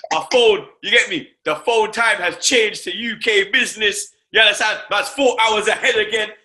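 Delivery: just landed home and can't contain it; real excitement at being back in London; excited. Real, not acted. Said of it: "I'm excited".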